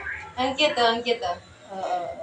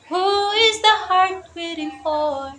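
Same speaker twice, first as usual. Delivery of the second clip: ordinary delivery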